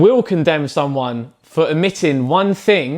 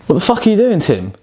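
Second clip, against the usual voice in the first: outraged, higher, demanding; angry; 3 semitones higher than usual. Acted. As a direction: confront him, exasperated. Catches housemate with his beer.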